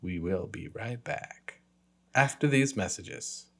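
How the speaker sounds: slow, drawn-out announcer sign-off. Pretend. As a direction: play it like an eighties TV break. Mock TV sign-off.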